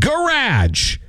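'garage' high, as guh-RADGE; decreed pronunciation. Shown as a demonstration. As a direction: pronounce 'garage' emphatically with j ending. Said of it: "So that's how we say it".

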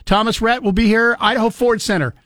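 upbeat ticket giveaway pitch; radio contest promo. Real, not acted. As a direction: sell the tickets to callers. Host hypes ticket giveaway.

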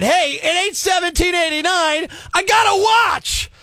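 high-pitched mock-outraged yell; exasperated rant. Repeats complaint in comic rant.

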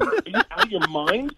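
high, outraged disbelief; mock incredulous. Scolding the host over his son's Jordans, mock outrage. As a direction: act stunned at how absurd it is.

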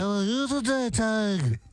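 badly faked English accent, played for laughs. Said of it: "I can't even do an accent of it".

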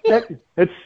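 words pitched high and bubbling with laughter; laughing while talking. Real, not acted. Host laughing as he answers the co-host's joke. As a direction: keep laughing through the line.

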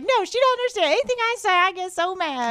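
high, hammed-up Southern whine; super southern accent. Southern friends griping about Siri, exaggerated Southern accent. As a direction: rattle off a mock Southern gripe, then stretch the end. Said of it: "they're super southern".

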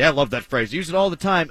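usual speaking voice

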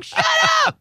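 retold outburst. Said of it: "He's like".

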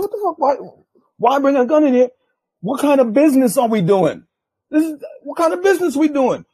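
very high, indignant outburst; heated retold rant, high and forceful; even madder. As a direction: act out exasperated disbelief, pitch way up. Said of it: "now I'm even mad".

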